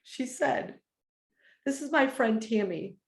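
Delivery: fighting back tears while telling it; getting emotional. Real, not acted. Telling her viewers about a friend's praise, choking up. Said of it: "now I'm gonna get emotional".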